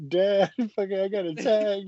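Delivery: lightly voiced whimpering child; mock crying kid. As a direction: play a scared kid half-laughing at himself. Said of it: "I would've been fucking crying".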